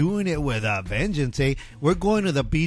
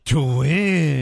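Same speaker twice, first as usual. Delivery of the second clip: jokey sing-song tag; 6 dB louder than usual. Pretend. Echoing phone number joke.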